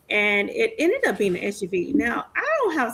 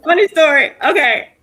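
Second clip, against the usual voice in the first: laughing; 4 semitones higher, 10 dB louder than usual. Real, not acted. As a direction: still laughing, much louder. Laughing, restarting the story.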